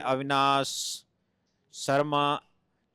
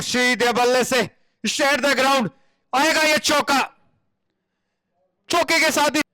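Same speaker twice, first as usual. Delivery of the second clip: high, excited boundary call; excited cricket commentary; 9 semitones higher, 3 dB louder, slower than usual. Acted. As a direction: rise for the four.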